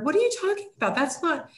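high, mock indignant interruption; a spouse cutting off the other, mock indignant. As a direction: cut in, scoffing, half-hearted.

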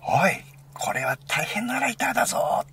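high, loud exclamation without dialect; imitated shout in standard Japanese. Retelling friend's reaction to the lighter, imitated standard-Japanese shout. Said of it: "って叫んだんだよな".

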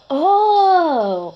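high, loud, drawn-out cry of "oh"; dismayed "oh". Real, not acted. Player reacting to own mistake, loud exclamation. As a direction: cry out at a botched shot.

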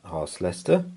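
ordinary delivery

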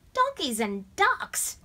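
high, loud, slow scoffing disbelief. A narrator's reading. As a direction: scoff at the very idea. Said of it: "Tom scoffed".

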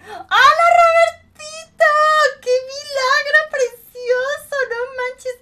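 thrilled high-pitched welcome to a viewer; ecstatic shout. Real, not acted. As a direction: shriek with delight at a returning friend.

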